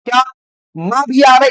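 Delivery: ordinary delivery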